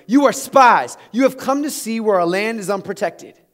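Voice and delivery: loud, accusing, as Joseph hurls the charge; harsh accusation. Acted. Joseph to his brothers, a loud harsh accusation. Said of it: "he speaks harsh to them".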